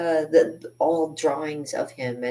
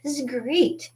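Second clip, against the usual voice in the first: high, bright-eyed yes; mock gushing enthusiasm; eager agreement; 12 semitones higher than usual. Eagerly agreeing to post painting.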